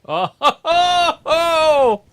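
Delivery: loud, high, slow "oh ho ho"; big gloating belly laugh; mocking laugh. Real, not acted. Wrestling fan mocking on TV.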